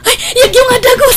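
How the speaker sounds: flustered mother rushing to answer. Acted. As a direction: scramble in a hurry. Mother rushing for son's call.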